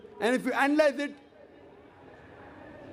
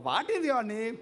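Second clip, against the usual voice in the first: South Indian accent. Shown as a demonstration. Speaker showing how someone might ask, in South Indian accent. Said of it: "If someone says".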